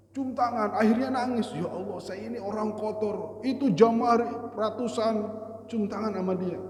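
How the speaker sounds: high and loud storytelling climax; fervent preacher; impassioned preaching. Acted. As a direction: build the story to its emotional peak. Preaching at full force.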